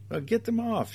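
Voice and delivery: high-pitched, agitated plea; alarmed. A narrator's reading. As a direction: demand it in a high, urgent voice. Woman swarmed by flies.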